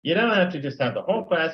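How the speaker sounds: usual speaking voice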